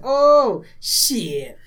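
loud, high "oh shit" of a caught-out pilot; mock dismay. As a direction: blurt it like a rogue caught lying. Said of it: "he's just like".

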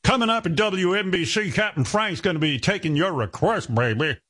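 high, hammed-up DJ promo; radio announcer voice. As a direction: sell it like an old radio jock. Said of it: "mock radio promo".

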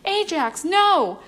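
high, urgent cry of protest; alarmed cry. A narrator's reading. Janet to Ajax before his stunt, alarmed cry. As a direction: cry out to stop him.

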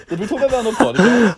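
laughing in disbelief; laughing question. Real, not acted. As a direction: blurt it out cracking up. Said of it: "I think we did".

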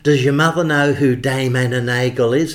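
imitating the boss. Boss asking about Dame Anna Nagel, grand booming imitation. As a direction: boom it out like an impresario. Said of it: "So he'd speak like it".

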